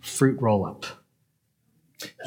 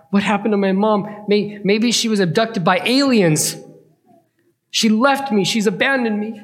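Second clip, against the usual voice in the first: put-on higher child's fretting; worried boy; 7 semitones higher than usual. Boy wondering where his mom went, fretting. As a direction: spiral into kid panic. Boy's frightened thoughts.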